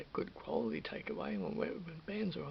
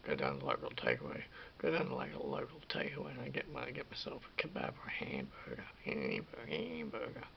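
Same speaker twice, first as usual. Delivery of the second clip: much lower mock bloke voice, grumbling about the stove; clueless bloke; 12 semitones lower than usual. Mocking guys who won't cook, in a much lower lazy bloke voice. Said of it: "some guys out there who are like".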